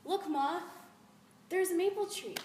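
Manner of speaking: put-on high, loud, excited child's call. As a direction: call out to Ma in thrilled discovery. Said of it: "I was so excited".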